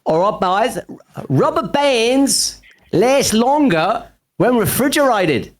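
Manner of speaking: high, slow put-on Aussie accent. Shown as a demonstration. Guest reading a useless fact to the hosts, hammed-up Aussie accent. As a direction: announce it slowly in a high mock Aussie voice. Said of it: "your finest Aussie accent".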